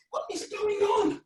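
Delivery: high-pitched baffled cry; hammed-up confusion; mock bewilderment. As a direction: squeak it in put-on disbelief. Feigning bafflement at the trick.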